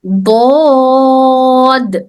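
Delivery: دود held very long, tongue edge on the molars; drawn-out dhad. Shown as a demonstration. Teacher demonstrating the letter to students, very slow and held. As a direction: model دود slowly so students hear the sound. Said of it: "کیسی آواز باہر نکل رہی".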